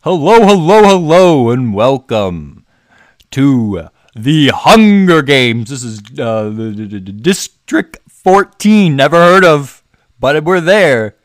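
very loud, high, drawn-out showy announcer; hammed-up Hunger Games host. Host welcoming all to the Hunger Games, hammed-up announcer. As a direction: boom out a grand, theatrical mock welcome.

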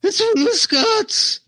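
high, slow, hammed-up royal sneer; over the top voice. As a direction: ham up the villain king. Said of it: "That ridiculous over the top voice".

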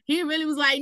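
ordinary talk

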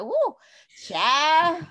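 exclaiming. Retold or imitated. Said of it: "I'm just like".

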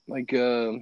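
ordinary delivery

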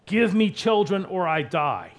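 loud, high, agonized demand; frustrated Rachel. Acted. Envious wife demanding children from her husband, loud frustration. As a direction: cry it out in desperate frustration. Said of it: "you can hear the frustration in Rachel's voice".

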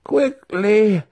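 very high, loud shriek; shrieking spirit. A narrator's reading. Spirit hurrying the wizard along, shrieking. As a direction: shriek it like an urgent ghost. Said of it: "the spirit shrieked".

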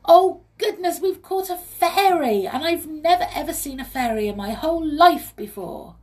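high, loud, excited exclamation; thrilled amazement. A narrator's reading. Molly has caught a fairy, thrilled. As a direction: gush with amazement, pitched well up.

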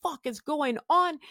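high-pitched retold panic without shouting; retold flipping out. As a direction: act out freaking out, voice pitched right up. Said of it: "And I'm flipping out".

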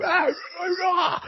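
high rock-singer wail; rock scream. Retold or imitated. Rock station jingle, high wailing shout. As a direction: wail like a metal frontman. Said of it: "proper rock station, you know, kind of".

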